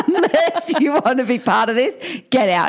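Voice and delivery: laughing warning. Retold or imitated. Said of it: "I said".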